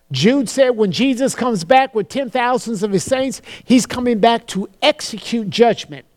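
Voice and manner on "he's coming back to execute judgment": normal talking voice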